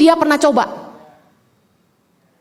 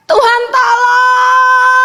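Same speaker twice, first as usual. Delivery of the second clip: drawn-out, slowed desperate plea; mock cry for help; 4 semitones higher, 3 dB louder, much slower than usual. Pastor imitating her own stressed cry to God, drawn-out plea. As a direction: cry out to God as if at wits' end. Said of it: "saya cuma teriak".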